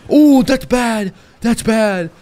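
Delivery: loud, high-pitched cry of dismay; real shocked exclamation; alarmed outburst. Real, not acted. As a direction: blurt it out loud and high at a disastrous play. Live reaction to a bad play.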